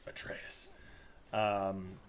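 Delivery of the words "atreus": breathy whisper, barely voiced; a hushed, tender whisper of a son's name. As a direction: say his name under your breath, moved. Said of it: "and he just goes".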